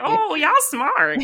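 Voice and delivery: high, loud, drawn-out amused discovery; impressed, slow and high-pitched; dawning realization. Retold or imitated. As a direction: stretch it out as it suddenly clicks. Acts out the moment of getting it.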